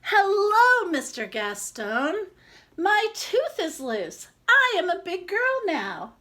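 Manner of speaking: put-on a little girl proud of her wobbly tooth; excited little girl. Madlenka tells the baker her news.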